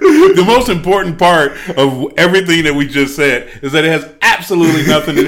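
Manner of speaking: loud, laughing through the words; can barely get it out for laughing; real laughter breaking up an announcement. Real, not acted. Laughing at their own tangent.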